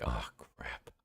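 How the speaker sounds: very quiet, low groan of dread; dismayed aside. Acted. Exorcist realizing the ghost called his bluff, quiet dismay. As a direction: mutter it under your breath, sinking.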